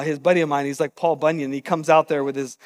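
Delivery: normal talking voice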